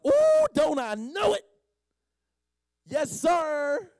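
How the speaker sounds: high, loud, slow burst of delight; the healed man, realizing it is Jesus, exclaiming with joy; joyful exclamation. Acted. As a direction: cry out in overjoyed recognition. Man's joyful recognition.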